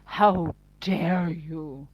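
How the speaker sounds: very slow, loud, put-on indignation; hammed-up, drawn-out affront; pompous mock outrage. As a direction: swell with pompous offence. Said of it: "They do sound so pompous".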